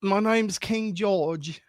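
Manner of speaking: slow, put-on British accent; deliberately slow fake British accent; mock British accent. Shown as a demonstration. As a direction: draw out a hammed-up British introduction.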